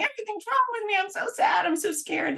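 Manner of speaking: high, whiny mock lament; self-pitying whine. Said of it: "crying to them and saying".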